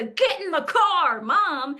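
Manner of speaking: high, loud "get in the car" snap; impatient nagging mom. Retold or imitated. Mom hurrying her kid to the car, impatient and loud. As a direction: bark it like a rushed, fed-up mom. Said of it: "not as the".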